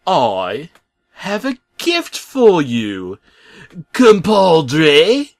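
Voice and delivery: put-on high, slow, bent British accent; warped British accent. Bob offering the truck a gift, in a warped high British accent. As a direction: let the British accent slip and warp. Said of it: "his British accent no longer sounding like a normal British".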